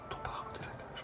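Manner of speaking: whispered read-through; chuchoté. Real, not acted. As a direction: whisper the line to yourself. Whispers a first read of line. (en chuchotant, pour soi)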